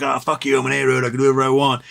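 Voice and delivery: cocky tavern thug voice; the A road cursing at the other roads in the pub, cocky bully voice; drunk bully A road. Retold or imitated. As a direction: sneer it like a bar bully mouthing off. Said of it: "and he's like".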